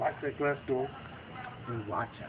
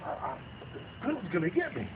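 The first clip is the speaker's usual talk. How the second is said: mock panic, voice climbing higher; hesitant start, then a higher frightened question; 10 semitones higher than usual. Cornered man fears the gorilla will get him, acted fright. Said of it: "He's, like, really frightened".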